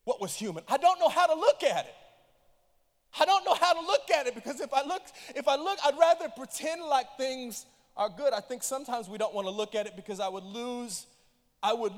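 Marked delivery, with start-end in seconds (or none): 0.7-7.7 loud, high cry of anguish; loud pained preaching cry; anguished outcry. Real, not acted. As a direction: cry it out as if torn.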